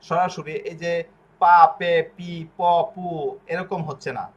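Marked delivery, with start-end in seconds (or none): 1.4-3.1 পা পে পি প পু spelled out one by one, each a crisp single syllable; louder syllable drill; single syllable demo. Shown as a demonstration. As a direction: sound out পা, পে, পি, প, পু loudly as separate syllables. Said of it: "এক অক্ষরের উচ্চারণ".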